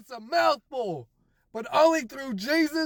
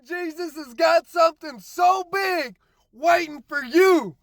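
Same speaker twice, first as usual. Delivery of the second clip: usual speaking voice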